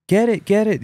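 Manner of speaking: put-on high, urgent child voice pointing at the wall; frightened child. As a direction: imitate the panicked little boy. And he's like.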